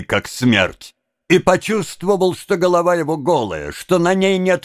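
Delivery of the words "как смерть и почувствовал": ordinary delivery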